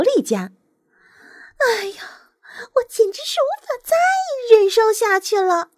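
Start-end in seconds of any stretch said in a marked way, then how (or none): 1.6-5.7 high, exasperated nightingale; high-pitched fed-up wail; complaining. A narrator's reading. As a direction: moan it high, at the end of patience. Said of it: "他抱怨道".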